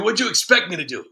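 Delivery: normal talking voice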